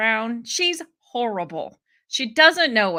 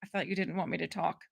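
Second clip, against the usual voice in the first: lower, quicker, softer retort; mock dry comeback; 5 semitones lower, 11 dB quieter, faster than usual. Host imitating a witness's retort at a hearing, mock dry comeback. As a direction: toss it off low and fast in imitation.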